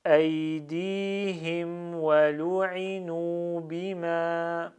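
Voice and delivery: slow, chanted Arabic recitation, drawn out; slow Quran recitation. Shown as a demonstration. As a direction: recite each word slowly and clearly. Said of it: "Je vais aller moins vite". (récitation lente et psalmodiée)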